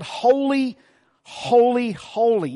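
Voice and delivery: first holy high, then falling away; angels crying out. Acted. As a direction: call it out like angels. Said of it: "The angels are crying out".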